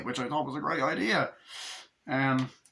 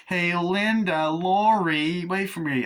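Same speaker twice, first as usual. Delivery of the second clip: high, slow call after friends; mock call to wait up; 6 semitones higher, 4 dB louder, slower than usual. A girl calling her friends on the street, higher.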